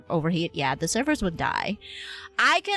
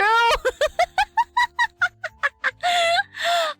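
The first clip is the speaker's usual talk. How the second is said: long, very high peal of laughter; laughing hard; 4 dB louder than usual. Real, not acted. Streamer cracking up at server joke, laughing hard.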